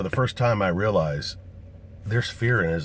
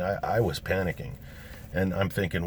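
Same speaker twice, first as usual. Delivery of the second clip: normal talking voice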